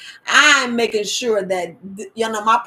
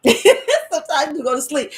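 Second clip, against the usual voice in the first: louder, laughing through the line; laughing repeat; 6 semitones higher, 5 dB louder than usual. Real, not acted.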